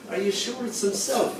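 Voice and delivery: soft, high questioning voice; inquiring. Acted. Changing cloud doubting who has returned, soft inquiring voice. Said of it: "inquired a changing cloud".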